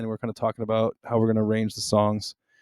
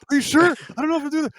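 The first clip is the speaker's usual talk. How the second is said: nervous high mock voice; 4 dB louder, faster than usual. Imitating nervous drummer.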